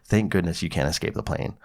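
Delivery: usual speaking voice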